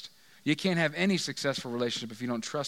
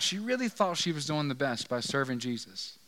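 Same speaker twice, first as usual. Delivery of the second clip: normal talking voice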